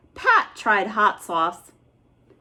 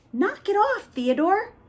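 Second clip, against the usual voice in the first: put-on higher annoyed kid voice; annoyed brother; 10 semitones higher than usual. Oscar scolding Theodore for the noise, annoyed kid.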